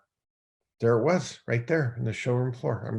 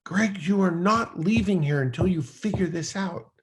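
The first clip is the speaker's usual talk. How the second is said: retold yell; 7 semitones higher than usual. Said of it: "I remember yelling".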